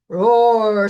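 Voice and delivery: loud spoken roar; Lion's roar. Acted. Lion roaring to scare off giants, loud. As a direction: roar it out big. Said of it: "says Lion".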